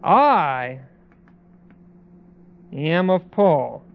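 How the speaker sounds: every "I" leaned on hard, the whole line slow and deliberate; stress on I. Shown as a demonstration. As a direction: punch each "I", pausing between claims. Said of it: "And the emphasis here was on the I".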